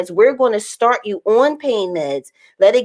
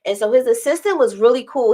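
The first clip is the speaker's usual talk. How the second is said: ordinary talk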